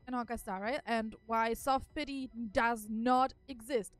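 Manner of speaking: usual speaking voice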